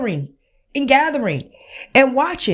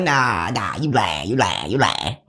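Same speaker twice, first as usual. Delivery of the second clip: much lower, dragged-out deep voice; mock deep disbelief; 3 dB quieter than usual. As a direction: drop way down like a doubting friend. Friend refusing to believe.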